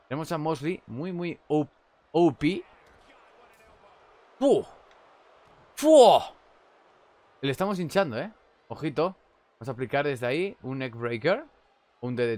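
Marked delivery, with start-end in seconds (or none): 5.7-6.2 loud, high "fu!" at the hit; loud exclamation. Real, not acted. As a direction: blurt it out at the blow. Reacción a un golpe. (exclamación fuerte de impacto)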